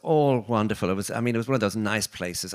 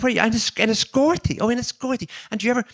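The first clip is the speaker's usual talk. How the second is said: put-on much higher, thicker Irish accent to a fellow countryman; sudden Irish accent; 14 semitones higher, 5 dB louder, faster than usual. Meeting the Irish maid in London, speaking in a broad, higher Irish accent. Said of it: "your accent changed".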